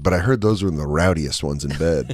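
low, hushed lecherous priest; lightly voiced sleazy priest, low and soft; creepy priest voice.

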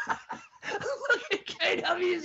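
high, slow words squeezed out through laughter; crying laughing. Real, not acted. Host and cohost dissolving into laughter over the grandma story, high and wheezy. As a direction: laugh till you can barely talk. Both cracking up.

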